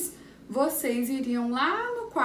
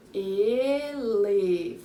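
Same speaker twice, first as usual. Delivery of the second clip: "Elefante" stretched as e, le, fan, te; very slow, full-voiced "Elefante" for counting; syllable by syllable; 4 dB louder, much slower than usual. Shown as a demonstration. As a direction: draw out four beats in "Elefante". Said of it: "Quatro sílabas".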